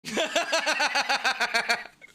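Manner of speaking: long, high rattling laugh. Real, not acted. Amused viewer watching a video, long high laugh. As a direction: burst into a long high cackle.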